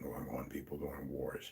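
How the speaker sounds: hushed unvoiced aside; whisper. Real, not acted. Host whispering a grim aside to listeners. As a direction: drop to a whisper for the grim fact.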